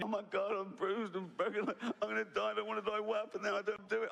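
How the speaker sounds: high, frantic survivor in the water; panicked. Pretend. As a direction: plead for your life, terrified.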